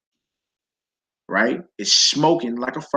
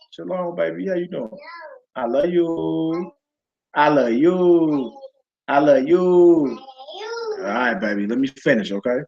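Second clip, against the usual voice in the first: tender aside; 3 semitones higher than usual. Real, not acted.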